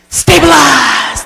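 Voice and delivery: sharp gasp, then slow drawn-out stabilize; gasp, then slowly settle yourself; calming mantra. Shown as a demonstration. Speaker demonstrating the meltdown whammy to consultants.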